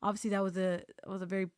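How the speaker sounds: ordinary talk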